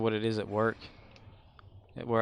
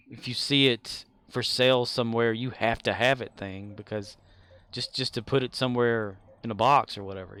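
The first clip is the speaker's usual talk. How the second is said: ordinary talk, nothing marked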